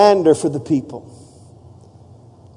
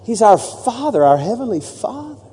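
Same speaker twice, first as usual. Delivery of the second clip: tender; 3 semitones higher, 6 dB quieter than usual. Acted. Tender turn after joke.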